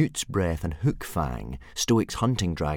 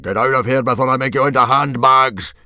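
loud, raised roaring threat; yell; 4 semitones higher, 10 dB louder than usual. A narrator's reading. Chief throws hunting dragons out, loud angry yell. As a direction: roar it in one angry burst. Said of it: "yelled Stoic".

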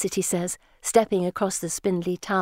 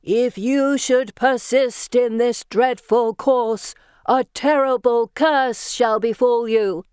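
slow, high, louder spectral voice; ghost voice; 5 semitones higher, 5 dB louder, slower than usual. Acted. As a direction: intone it like a haunting spirit. Girls pretend to be ghosts.

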